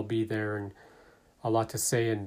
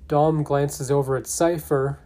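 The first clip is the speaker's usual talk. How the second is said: normal talking voice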